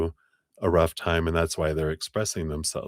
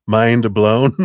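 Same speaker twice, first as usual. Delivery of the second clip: laughing; 4 semitones higher, 9 dB louder than usual. Real, not acted. Playful rhetorical question, laughing.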